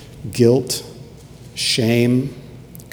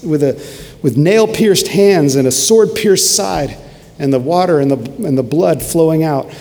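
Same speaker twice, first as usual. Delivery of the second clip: higher-pitched, surging sermon delivery; impassioned preaching; 4 semitones higher than usual. Acted. Preacher picturing the crucified Jesus for the congregation, impassioned. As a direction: preach it with mounting fervour.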